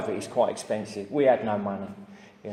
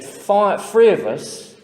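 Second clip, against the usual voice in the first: emphatic warning; 8 semitones higher, 8 dB louder, much slower than usual. Retold or imitated. Fellow patient's stark warning.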